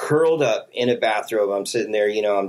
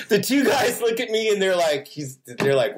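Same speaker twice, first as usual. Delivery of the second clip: ordinary talk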